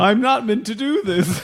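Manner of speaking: hammed-up alarm with a laugh; mock dismay. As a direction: play the mortified intruder, amused. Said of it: "the look on their face was like".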